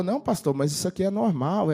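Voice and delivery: barely audible croak; hoarse voice. Retold or imitated. Pastor voicing the hoarse minister in the car, croaky and soft. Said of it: "Falava bem fanho e quase não dava pra ouvir".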